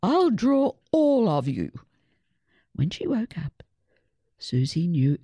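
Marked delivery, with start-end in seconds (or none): 0.0-1.7 ordering. A narrator's reading. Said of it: "Susie ordered".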